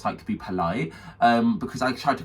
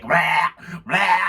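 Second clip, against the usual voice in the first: loud, high mock retches; imitated vomiting; 7 semitones higher, 6 dB louder than usual. Friend about to be sick, mock vomiting. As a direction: heave out two noisy gags.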